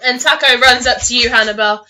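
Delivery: ordinary delivery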